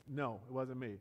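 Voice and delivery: ordinary delivery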